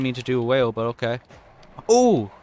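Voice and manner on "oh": high, loud oh; impressed surprise. Real, not acted. Gamer surprised by own trick, impressed exclamation. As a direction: burst out with a startled oh.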